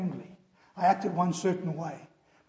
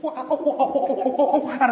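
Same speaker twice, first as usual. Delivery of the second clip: high, loud, slow hammed-up chimp voice; mock chimpanzee; 6 semitones higher, 6 dB louder than usual. Boy clowning as a chimpanzee for classmates, hammed-up. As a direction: ham it up like a chimp running in. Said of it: "I pretend I'm a chimpanzee".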